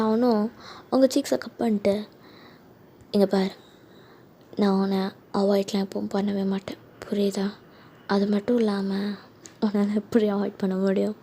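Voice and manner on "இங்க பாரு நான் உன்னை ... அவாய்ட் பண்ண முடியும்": soft, hushed voice of a fiancé; tender reassurance. Acted. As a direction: gently soothe the crying bride. Fiancé soothing crying bride.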